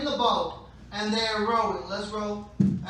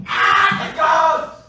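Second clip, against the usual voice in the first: high, very loud cry of alarm; scared scream; 8 semitones higher, 16 dB louder, slower than usual. Acted. Disciples acting out the boat scene on request, scared scream. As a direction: shriek it in acted terror. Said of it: "they were so scared".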